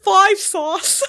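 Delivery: loud, high call at the door; loud announce. Acted. A guest announcing the band at the door.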